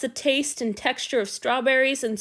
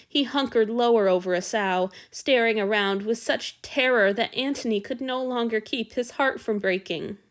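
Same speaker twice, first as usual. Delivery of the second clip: ordinary delivery, nothing marked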